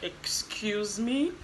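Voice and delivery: drawn-out, rising mock disbelief; high, slow, scandalised query; incredulous. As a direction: stretch it out as an affronted question. Reacting to a childish insult.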